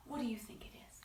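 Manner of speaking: soft unvoiced question; whisper. Whispering a question to the producer, playfully.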